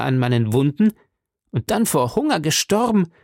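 a hint of a martyred gallant; aggrieved Porthos. A narrator's reading. Porthos complaining, voiced higher.

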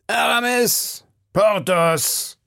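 high, drawn-out call of names; warnender Zuruf. A narrator's reading. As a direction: call out to break up a quarrel. Onlookers call out to quarrelling musketeers. (mahnend und hoch gerufen)

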